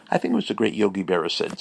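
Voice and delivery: normal talking voice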